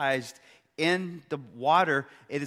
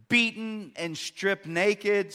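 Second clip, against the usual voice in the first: usual speaking voice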